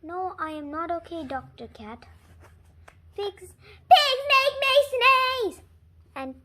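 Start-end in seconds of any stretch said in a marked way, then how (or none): 3.1-5.6 higher, louder, slow complaint; mock wail. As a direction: cry it out in despair. Gerald wails to the doctor.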